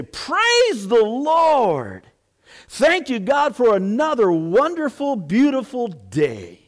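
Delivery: high, loud, slow joyful praise shout; top of her lungs. Retold or imitated. Old lady shouting praise from her backyard, joyful full-voiced cry. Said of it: "just out on the top of her lungs say".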